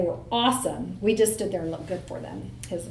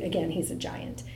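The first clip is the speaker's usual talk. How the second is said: ordinary talk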